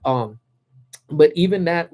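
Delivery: ordinary talk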